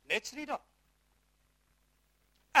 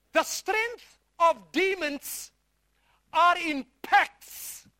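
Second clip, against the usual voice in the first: very slow, weighty delivery; emphatic slow; 4 dB louder, much slower than usual. Real, not acted. Preacher driving home his point to congregation, slowly. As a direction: drag each word out for weight.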